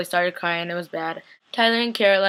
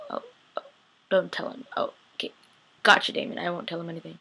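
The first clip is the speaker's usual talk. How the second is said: hushed, mostly whispered aside; acted secretive aside; 3 semitones lower, 7 dB quieter, faster than usual. Promising Damon not to tell him, in a secretive hush. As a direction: whisper it like a conspirator.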